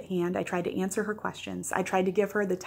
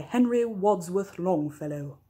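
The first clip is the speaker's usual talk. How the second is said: slow, drawn-out flourish on a poet's name; playfully grand name; slower than usual. Pretend. Speaker introducing the poem's author for fun, playfully grandiose. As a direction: savour each name for fun, stretched out. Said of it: "have to say it like that".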